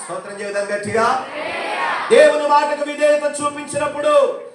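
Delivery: high, loud storytelling sermon; fervent preaching. Real, not acted. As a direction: drive the story home to the congregation. Raised preaching of the Bible story. (ఉత్సాహంగా ఎత్తైన స్వరంలో బోధన)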